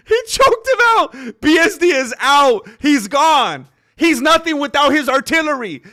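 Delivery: loud, high triumphant yelling; excited shouting. Real, not acted. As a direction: yell it like you called it. Called the fight result, shouting.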